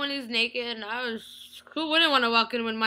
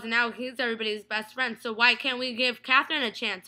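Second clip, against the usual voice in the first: ordinary talk, nothing marked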